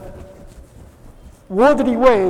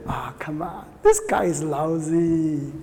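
lower, drawn-out mock disdain; dismissive attitude; 6 semitones lower, 5 dB quieter, slower than usual. Proud speaker belittling other speakers, dismissive. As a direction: act out a slow, scornful dismissal of someone. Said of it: "This is not the right attitude".

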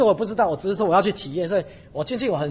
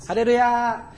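building exclamation; 5 semitones higher, 5 dB louder than usual. Real, not acted. Preacher building to climax.

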